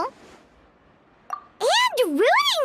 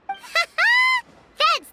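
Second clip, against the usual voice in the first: laughing; 8 semitones higher than usual. Real, not acted.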